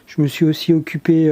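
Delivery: ordinary talk, nothing marked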